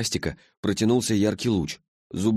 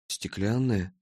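crestfallen, subdued echo; dismayed boy, understated; sinking voice; 3 dB quieter, much slower than usual. A narrator's reading. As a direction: repeat it with your heart sinking. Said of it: "упавшим голосом повторил Ластик".

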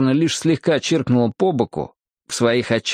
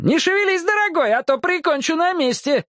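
character's yell. A narrator's reading. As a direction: yelp the threat in a high put-on voice. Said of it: "завопил Джексон". (угроза высоким воплем)

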